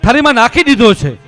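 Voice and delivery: high, loud back-and-forth pleading and refusing; loud heated plea. Acted. Wife and sage loudly arguing over the meal. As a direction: push the scene to its loudest.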